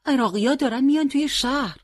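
high, alarmed report to the commander; hurried, frightened. A narrator's reading. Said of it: "شتاب زده و هراسان گفت".

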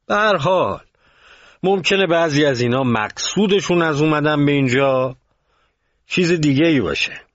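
object, not yet convinced; unconvinced objection. A narrator's reading. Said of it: "که قانع نشده بود گفت".

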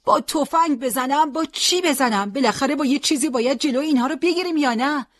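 high-pitched, heated argument; heated, exasperated protest that speeds up at the end; defiant retort. A narrator's reading. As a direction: argue back stubbornly over the battle. Old man argues back under attack.

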